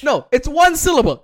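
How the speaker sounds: normal talking voice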